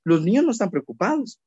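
ordinary delivery

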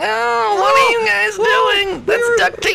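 very high, loud panicked shriek; shrieking creature. Acted. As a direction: scream it in squeaky panic. Creature cries out at capture.

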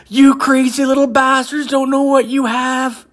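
very high, drawn-out mock shriek; mock screaming. Driver honking and waving at the kids, mock screaming. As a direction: holler it slowly in a hammed-up squeal.

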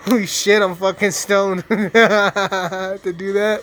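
loud burst, breaking into laughter; laughing. Real, not acted. Stoned gamer amused by his own voice bit, laughing loudly. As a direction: crack up loudly at your own silly bit.

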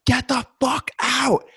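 high, drawn-out scream; acted-out panicked yell. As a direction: scream it slowly at the intruder. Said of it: "I'm just screaming".

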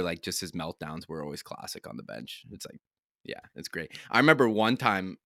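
ordinary delivery

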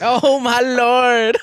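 drawn-out, high cry through laughter; laughing disbelief. Real, not acted. Reacting to co-host's crude joke, laughing disbelief. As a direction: stretch it out, cracking up.

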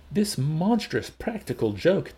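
slow, high-pitched outrage; angry shout. A narrator's reading. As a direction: spell out your fury word by word. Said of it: "the director shouted, red in the face".

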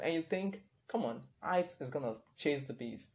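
ordinary delivery

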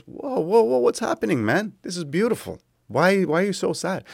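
a high-pitched, baffled friend asking what's wrong; Freud, bewildered. Retold or imitated. Freud asking his weeping friend why he's sad, high baffled voice. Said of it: "Freud goes".

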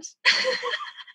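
high squealing laugh; real giggle at the punchline. Real, not acted.

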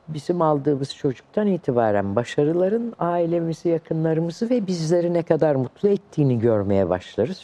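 ordinary delivery

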